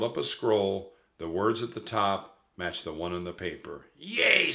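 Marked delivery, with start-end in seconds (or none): 4.0-4.5 cheering. A narrator's reading. Jack cheers.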